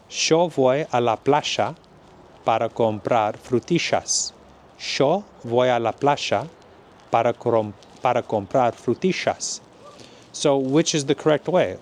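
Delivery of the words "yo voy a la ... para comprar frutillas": yo said as sho, playa as plasha, slow; Argentinian Spanish. Shown as a demonstration. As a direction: Rioplatense accent, "yo" sounds like "sho". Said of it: "someone from Argentina".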